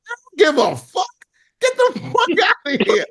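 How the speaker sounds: high, mocking put-on voice for Mike; mock Jordan brush-off.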